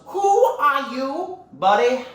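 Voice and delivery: loud, high, very slow challenge; drawn-out scornful question; Tai Lung. Acted. Villain challenges the newcomer, loud and slow. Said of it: "Talon goes".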